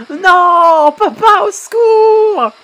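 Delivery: high, slow, desperate cry for help; panicked plea. Acted. Trapped son crying out to his father, high panicked plea. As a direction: beg your father to save you.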